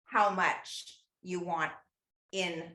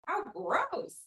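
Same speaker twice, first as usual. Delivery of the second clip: put-on kid's disgust; 10 semitones higher than usual. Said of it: "you say".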